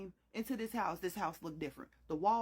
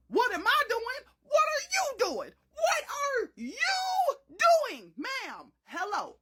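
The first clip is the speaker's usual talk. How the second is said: very high, loud, stretched what are you doing; incredulous shout; 12 semitones higher, 6 dB louder, slower than usual. Retold or imitated. Child shouting at her mama, high incredulous yell. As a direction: wail at mama in disbelief.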